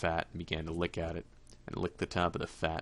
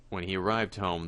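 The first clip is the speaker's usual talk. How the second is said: ordinary delivery, nothing marked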